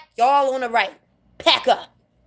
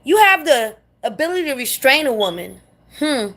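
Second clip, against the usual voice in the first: ordinary talk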